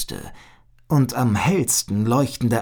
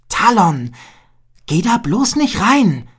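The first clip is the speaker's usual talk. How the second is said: warning; 8 semitones higher, 6 dB louder than usual. A narrator's reading. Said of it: "warnte ihn Agila".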